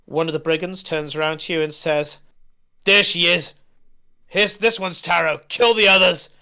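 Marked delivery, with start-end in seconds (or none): 2.9-6.3 loud, rough brigand yelling orders; brigand shout. Acted. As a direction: bellow it across the fight.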